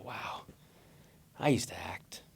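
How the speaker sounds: soft breathy whisper. Retold or imitated. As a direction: breathe it out under your breath. Dry aside to depressed runner.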